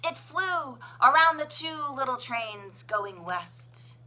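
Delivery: ordinary delivery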